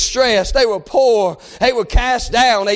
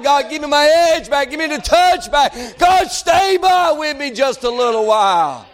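impassioned preaching; 4 semitones higher than usual. Acted. Preacher pleading with God for His touch, impassioned. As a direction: preach it as a fervent plea.

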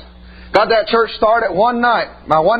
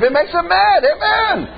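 fiery preaching; 8 semitones higher than usual. Real, not acted. Preacher rousing the congregation against the devil, fiery preaching.

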